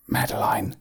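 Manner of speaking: soft, whispered call; hushed little bear calling. A narrator's reading. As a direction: whisper the name softly through a door. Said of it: "Gregor whispered through the door".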